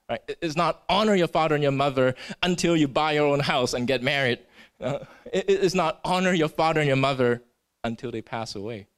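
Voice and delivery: ordinary talk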